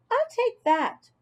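put-on light, high kid voice; high child voice. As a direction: play a young kid, bright and high. Child character voice.

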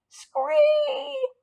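long, very high screech; storybook animal screech; frog screech. A narrator's reading. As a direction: hold a piercingly high frog cry. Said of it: "Og repeated".

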